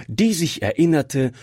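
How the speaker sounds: ordinary delivery, nothing marked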